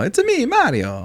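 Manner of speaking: a hint of a Mario accent; light Italian Mario impression. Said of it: "Chris Pratt does the voice".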